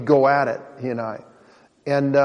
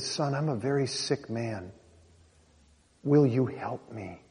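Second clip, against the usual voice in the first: soft, slow, broken plea; much softer, slow, barely holding together; dad crying; 4 semitones lower, 12 dB quieter, slower than usual. Retold or imitated. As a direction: drunk father begging his son for help, softly. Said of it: "he started to cry".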